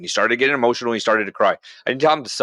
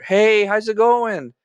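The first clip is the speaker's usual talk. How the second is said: cheery phone voice; 6 semitones higher than usual. Shown as a demonstration. Husband cheerfully ending the wife's phone call, upbeat and friendly. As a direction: breezily wrap up the call with the mother-in-law. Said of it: "How do I transform the temperature".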